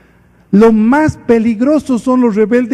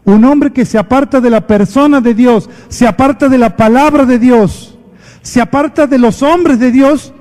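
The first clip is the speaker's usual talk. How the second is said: louder, building preacher's warning; preaching climax; 4 semitones higher, 4 dB louder than usual. Acted. Pastor warning the congregation who stray, loud and building. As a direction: drive it home with rising force. Sermon climax, rising intensity.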